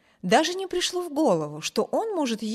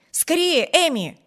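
higher, louder, hurrying call; impatient excited shout; 6 semitones higher, 5 dB louder than usual. A narrator's reading. Wibert hurrying his sister to the cart, impatient shout. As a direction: urge her on, can't wait to leave. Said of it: "крикнул Виберт с возбуждённым нетерпением".

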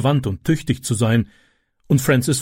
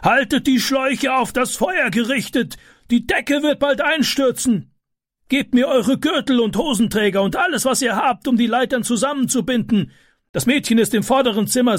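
high, commanding shout; man directing helpers in a high, urgent voice; neighbour shouting orders; 11 semitones higher than usual. A narrator's reading. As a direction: take charge of the crowd at the fire. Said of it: "rief er".

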